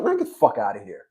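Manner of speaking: low, dropped brush-off; quiet retold dismissal; dismissive brush-off. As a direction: wave him off under the breath. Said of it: "I said".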